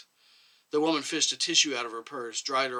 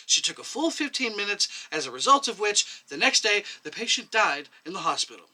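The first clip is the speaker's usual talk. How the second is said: a lightly higher, insistent accusing voice; indignant young doctor; 8 semitones higher, 4 dB louder than usual. A narrator's reading. Young doctor lays out the nurse's guilt, lightly raised voice. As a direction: press the charge, clearly offended.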